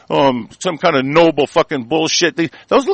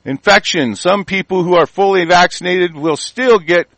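ordinary talk, nothing marked

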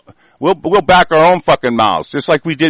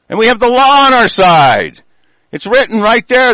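rising shout; 6 semitones higher, 4 dB louder than usual. Real, not acted. Rant building to climax.

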